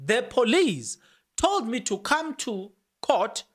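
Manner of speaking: slow and deliberate, leaning on told. Shown as a demonstration. Rereading the confession for viewers, very slow. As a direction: read it slowly, stressing told. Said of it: "let me say it very slow".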